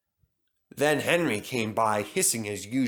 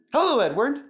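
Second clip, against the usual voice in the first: much higher, louder greeting with a rise; cheery Thomas; 12 semitones higher, 5 dB louder, faster than usual. Acted. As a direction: greet a friend brightly. Thomas voiced.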